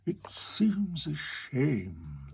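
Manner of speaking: very slow, regretful walrus. Acted. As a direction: sigh it out slowly. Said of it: "the walrus said".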